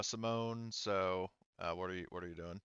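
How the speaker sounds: ordinary delivery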